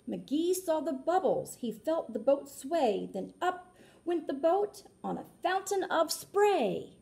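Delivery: lilting read-aloud rhyme; storybook narration. A narrator's reading. Reading a rhyming book aloud to children, lilting. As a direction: read it like a picture book for kids.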